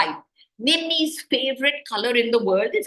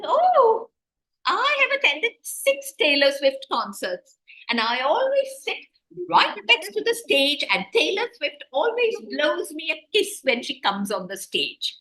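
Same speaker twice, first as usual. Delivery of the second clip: high, boastful one-upping voice; show-off girl; 6 semitones higher than usual. Retold or imitated. Rich classmate one-ups a friend, high boastful tone. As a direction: brag like the girl who has more. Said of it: "and she says".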